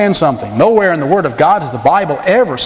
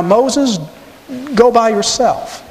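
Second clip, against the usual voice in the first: higher, slow, scheming condition; sly Pharaoh; 6 semitones higher than usual. Retold or imitated. Preacher acting Pharaoh setting his trap for Moses, higher. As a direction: slip in the catch with a sly higher voice. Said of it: "But now".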